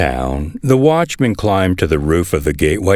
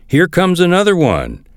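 calling down; 11 semitones higher than usual. A narrator's reading. Watchman reports a runner to the king, calling down. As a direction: read a shout with lifted pitch, little force. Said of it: "He shouted down".

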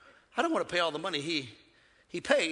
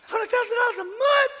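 amazed disbelief; 6 dB louder than usual. Acted. Mock disbelief at her income.

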